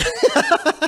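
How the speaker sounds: sudden high-pitched laugh; high burst of laughter. Real, not acted. New father laughing at his own joke, high laugh. Laughs at planning family around friend.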